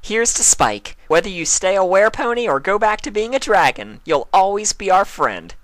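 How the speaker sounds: high, loud cheerful toast; Pinkie toast. A narrator's reading. Pinkie toasting Spike, high and cheerful. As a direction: raise a glass warmly.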